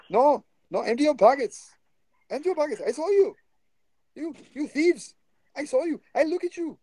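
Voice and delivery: Indian accent impression. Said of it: "accuracy of accent replication is unimportant".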